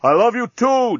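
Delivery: high, loud answering call; shouting back. A narrator's reading. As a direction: shout it back across the cells. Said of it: "Dan shouted back".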